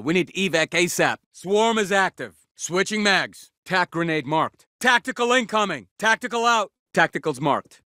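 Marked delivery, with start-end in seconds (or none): 4.8-6.7 high battle warning; raised-pitch combat alert; urgent callout. Acted. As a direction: call it out to the squad.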